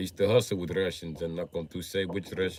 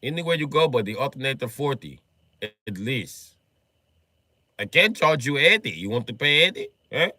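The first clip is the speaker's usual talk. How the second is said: put-on Russian accent; 5 semitones higher, 5 dB louder than usual. Russian seller haggling.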